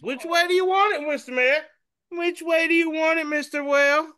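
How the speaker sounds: very high, fast teasing offer; squeaky mock-polite question to the whale; high cartoon Moses teasing the whale; silly cartoon voice.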